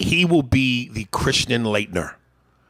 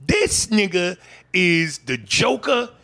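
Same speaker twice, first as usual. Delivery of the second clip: slow emphatic rant; 7 semitones higher, 3 dB louder, slower than usual. Real, not acted. Building rant about Andy.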